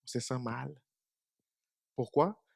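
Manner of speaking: ordinary talk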